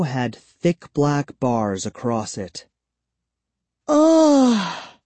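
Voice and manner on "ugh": loud high disgusted ugh; disgusted groan. Acted. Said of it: "With a disgusted groan".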